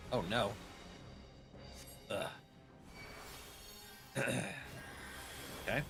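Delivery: ordinary talk, nothing marked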